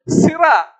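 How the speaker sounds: high, loud hawker's cry; imitated vendor's shout. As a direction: shout your wares across the market. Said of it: "Ano yung sigaw nung isa?".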